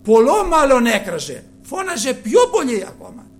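loud, high, forceful preaching; fiery preaching. Real, not acted. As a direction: build it up, thundering to the crowd. (φλογερό κήρυγμα με δυνατή φωνή)

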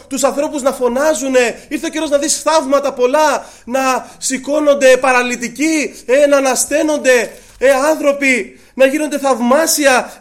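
loud, driving sermon delivery; full-force preaching. Acted. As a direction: rouse the congregation with it.

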